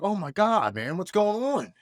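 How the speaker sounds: light up as you recognise him on the phone; surprised recognition. Retold or imitated. Old Marine buddy calls unexpectedly.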